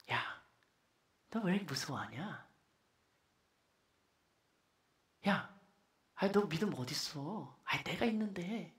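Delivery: soft, low, gentle chiding; calm, tender reproach. Acted. Preacher acts Jesus reassuring frightened disciples, calm and tender. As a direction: speak quietly, with pity and expectation. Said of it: "차분하게 예수님이 제자들한테 얘기했을 것 같아요".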